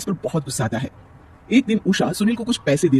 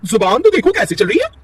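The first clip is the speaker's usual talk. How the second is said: threatening; 9 semitones higher, 5 dB louder than usual. Acted. Kirti threatens Nisha.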